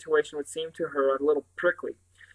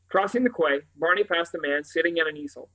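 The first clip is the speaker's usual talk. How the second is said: usual speaking voice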